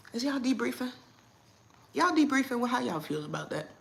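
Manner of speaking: normal talking voice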